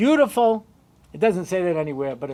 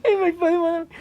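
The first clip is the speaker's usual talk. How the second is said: high mock whine; whiny voice; 10 semitones higher, faster than usual. Eliezer complaining about his father in a high mock whine. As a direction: play the sulky kid, way up high.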